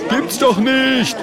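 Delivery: high squawk of disbelief; disbelieving shout. Acted. A spectator doubting the Murk, high incredulous cry. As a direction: call it out high from the crowd. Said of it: "ungläubiges Raunen durch die Menge und die Leute rufen".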